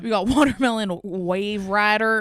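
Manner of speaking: a hint of a deadpan budtender; mock budtender. Budtender listing silly strain names.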